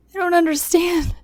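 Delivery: high, tearful pleading; choked, confused apology; pleading sobs. Acted. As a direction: beg through tears, voice up high. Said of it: "I pleaded behind sobs".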